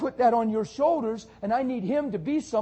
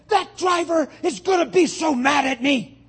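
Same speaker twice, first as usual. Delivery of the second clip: anxious cry; 7 semitones higher, 6 dB louder than usual. Retold or imitated. Upset lady retold.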